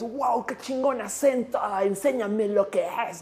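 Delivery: slow, wide-eyed delighted "wow"; amazed admirer. Acted. Imagined kid praising a Polish accent, slow and delighted. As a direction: marvel at the accent.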